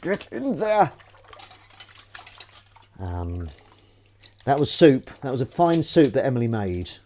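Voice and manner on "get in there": excited cheer. Real, not acted. Cheering the water down sink.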